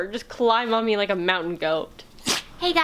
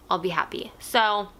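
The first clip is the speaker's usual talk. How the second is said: ordinary delivery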